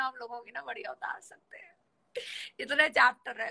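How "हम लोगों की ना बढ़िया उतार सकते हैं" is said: whisper. Real, not acted. Sudden whisper at line end.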